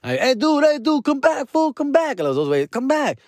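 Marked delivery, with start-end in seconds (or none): 0.2-2.2 high-pitched, repeated calling; re-enacted hail, pitched way up; calling someone back. Calling departing men back. 2.7-3.2 high-pitched call; re-enacted hail, pitched up; calling someone back. Calling departing men back.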